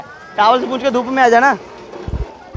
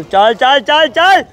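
cheering on; 8 semitones higher than usual. Real, not acted. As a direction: yell Chintu down the pool. Cheering Chintu to gold.

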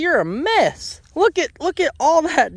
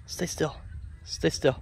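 soft coaxing; 6 semitones lower, 10 dB quieter than usual. Real, not acted. Calming the goat close up.

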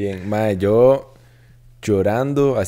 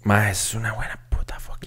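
breathy unvoiced whisper, much softer; whispered praise; 7 dB quieter than usual. Real, not acted. Raving to the cohost about a classic film, whispering. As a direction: hush it like a secret.

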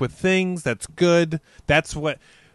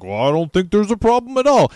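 higher mock cop voice; dismissive cop; 7 semitones higher than usual. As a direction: brush it off like a useless cop. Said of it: "the cop's like".

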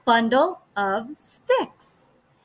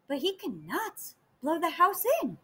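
plain reading voice

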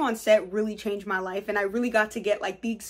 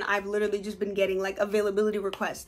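normal talking voice

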